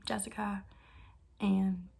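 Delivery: ordinary talk, nothing marked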